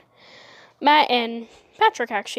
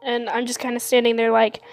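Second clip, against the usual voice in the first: ordinary delivery, nothing marked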